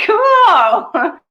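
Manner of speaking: high, loud, drawn-out whoop; delighted cheer. Acted. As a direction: belt it out with glee. Delighted cheer capping acted affirmation.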